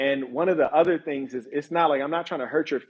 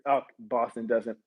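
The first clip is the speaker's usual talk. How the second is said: ordinary talk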